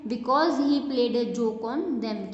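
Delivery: word by word, very slow and clear; slow classroom read-aloud; slow dictation. Shown as a demonstration. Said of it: "teacher reads sentence aloud".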